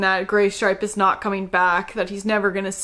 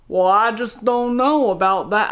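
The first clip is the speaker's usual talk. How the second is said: put-on Southern accent; 4 dB louder than usual. Imitating the audiobook Ashfur mid-fight, Southern accent. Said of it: "Ashfur literally has a southern accent".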